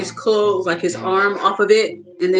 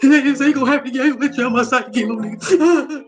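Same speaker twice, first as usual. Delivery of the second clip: higher, hammed-up blubbering; mock crying; 3 semitones higher, faster than usual. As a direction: overdo the tearful plea. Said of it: "he getting all dramatic".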